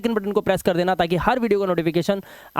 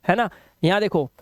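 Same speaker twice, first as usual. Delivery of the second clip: ordinary talk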